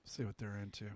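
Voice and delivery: whispered aside. Real, not acted. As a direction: breathe it out unvoiced. Aside to sibling.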